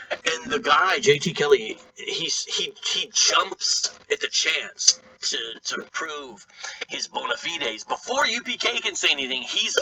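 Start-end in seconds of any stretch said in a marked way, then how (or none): none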